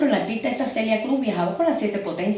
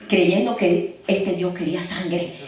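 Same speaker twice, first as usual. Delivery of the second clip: normal talking voice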